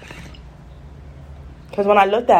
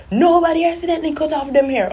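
much higher-pitched, indignant mom impersonation; imitated scolding mother; 12 semitones higher than usual. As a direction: cut in as the mother refusing the excuse. Voices mother cutting her off.

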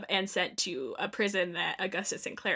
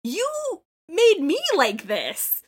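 higher, louder, slow and seething; furious retort; 9 semitones higher, 7 dB louder, slower than usual. Acted. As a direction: spit each word back at him. Voicing Delta's angry retort.

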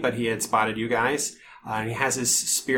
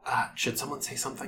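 hushed; 7 dB quieter, faster than usual. Acted. Party frozen, hiding from guard.

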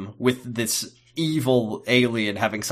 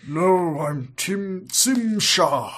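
higher, put-on villain voice; villain correcting them; 6 semitones higher, much slower than usual. Alien correcting the crew, hammed-up insistence. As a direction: draw out the name as a thwarted villain. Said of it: "It's like".

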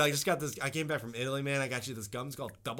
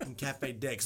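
ordinary delivery

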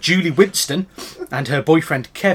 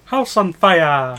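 comic catchphrase voice; 2 semitones higher, much slower than usual. Retold or imitated. Quoting comedy catchphrase.

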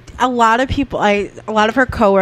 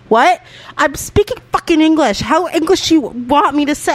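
indignant mom; 8 semitones higher than usual. Retold or imitated. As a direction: throw it back like an offended mother. Mom retorting to teasing daughter.

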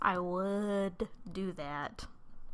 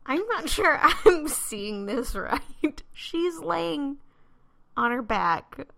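incredulous; 6 semitones higher, 6 dB louder, slower than usual. Real, not acted. Incredulous reaction while laughing.

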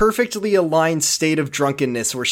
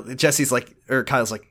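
ordinary talk, nothing marked

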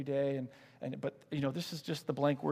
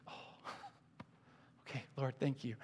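hushed, laughing thanks; 2 semitones lower, 11 dB quieter than usual. Retold or imitated. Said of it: "I was like".

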